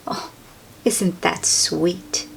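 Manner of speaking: ordinary delivery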